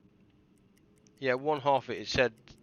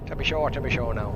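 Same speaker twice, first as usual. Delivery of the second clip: mock Irish accent; 5 dB louder than usual. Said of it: "I love the Irish accent".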